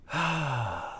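long, soft sigh of defeat; weary sigh. Real, not acted. Host gives up on stubborn people, weary sigh. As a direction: let out a spent, heavy breath. Spent after the rant.

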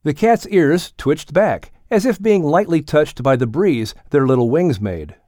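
usual speaking voice

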